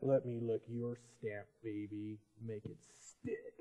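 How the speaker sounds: low, soft sleazy come-on; hushed mock-seductive whisper at the end; sultry joke voice. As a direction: purr it like a cheesy seducer. Mock seductive stamp line.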